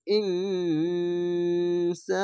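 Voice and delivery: ইনসা chanted very slowly with the ন held as a nasal hum; ইনসা with a long nasal ghunna, very slow; nasal ghunna recitation. Shown as a demonstration. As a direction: hum the ন through the nose before সা. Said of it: "বাংলা অনেস্কারের মতো উচ্চারণ করতে হবে".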